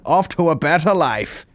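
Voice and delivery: put-on slow, high English accent; old English guy. Old English guy telling the orphans left behind to shut up. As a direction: stretch it out like an old English gent. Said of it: "an old English guy".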